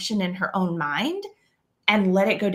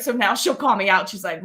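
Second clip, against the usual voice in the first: ordinary talk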